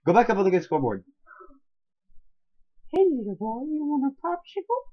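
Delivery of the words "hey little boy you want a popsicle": very high, comic character voice; put-on stranger voice. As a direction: coax a child in a silly squeaky pitch. Repeats the character bit.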